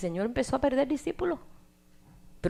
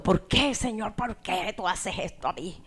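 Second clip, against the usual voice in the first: accusing. Shown as a demonstration. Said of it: "Así acusando".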